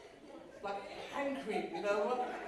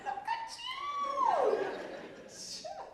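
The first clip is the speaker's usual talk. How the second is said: put-on much higher, slow cooing over the baby; gushing grandparents; much slower than usual. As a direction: coo at the grandchild in a sky-high voice. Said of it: "They're like".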